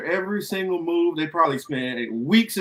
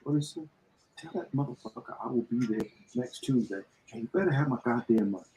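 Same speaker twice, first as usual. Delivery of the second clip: hushed tough-talking aside; much softer, under-the-breath mock threat; Bowman; 4 semitones lower, 10 dB quieter, faster than usual. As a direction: drop low in volume for a streetwise threat. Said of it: "and be like".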